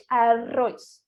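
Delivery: "arroz" with a trilled RR; rolled RR. Shown as a demonstration. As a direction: roll the RR in "arroz". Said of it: "Mais uma vez".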